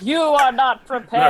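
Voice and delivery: Illidan. Retold or imitated. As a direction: draw it out as a grand villain line. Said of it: "and it's Illidan".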